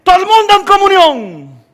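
high, loud mock call to the congregation; mock alarm call. Someone in the church calling everyone to unite, loud alarm. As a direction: cry it out like a panicked church elder. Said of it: "alguien dice".

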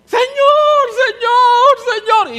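high loud pleading Señor, Señor, Señor; hammed-up whining plea; begging husband. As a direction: wail it like a needy husband. Husband voiced again.

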